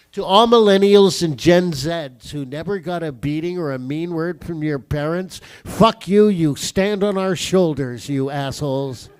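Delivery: loud, punchy telling-off; loud rant. Acted. Comic tells off pampered millennials, loud rant. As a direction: berate a whole generation at full volume. Mock rant at younger generations.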